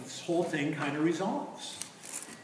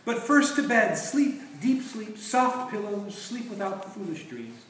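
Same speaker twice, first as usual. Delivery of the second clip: higher, louder, slowing into sleep; lulling witch voice; 5 semitones higher, 5 dB louder, slower than usual. Retold or imitated. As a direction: croon them to sleep. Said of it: "witch's enchantment scene".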